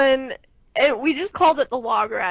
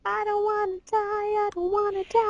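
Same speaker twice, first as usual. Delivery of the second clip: mock whimper; 7 semitones higher, 7 dB quieter than usual. Mocking fear of rides.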